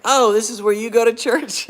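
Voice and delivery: high, loud surprised exclamation; surprised churchgoer. Retold or imitated. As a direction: gasp it out, caught off guard. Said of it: "they're like".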